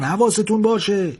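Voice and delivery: acted sailors' shout; shouted warning. A narrator's reading. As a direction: call out a warning across the deck. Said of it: "چند نفر داد زدن".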